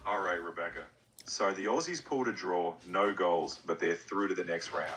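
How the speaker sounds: put-on Australian accent; Aussie accent. Co-host telling Australian viewer about the tie, Aussie accent. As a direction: explain it casually in an Aussie accent. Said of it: "give us your best Aussie accent".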